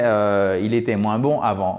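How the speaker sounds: ordinary talk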